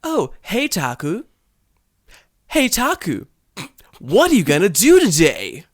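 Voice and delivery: put-on high, loud sing-song textbook girl; English lesson recording. Emily asking Taku on a recording, hammed-up textbook voice. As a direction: exaggerate the stilted pitch swoops. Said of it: "And she's like".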